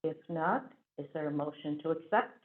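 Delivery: ordinary delivery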